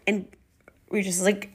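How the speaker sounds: ordinary delivery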